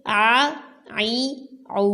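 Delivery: عا عی عو squeezed from mid-throat; teacher drilling عین vowels; ain drill. Shown as a demonstration. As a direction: tighten the throat on each عین syllable. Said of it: "عین حلق کے درمیان والے حصے سے".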